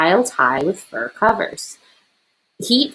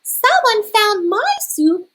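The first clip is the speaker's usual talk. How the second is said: much higher, slow squeaky sputter; high squeaky baby bear; 3 dB louder, slower than usual. A narrator's reading. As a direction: squeak it out like an upset little cub. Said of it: "sputtered Baby Bear in her high, squeaky voice".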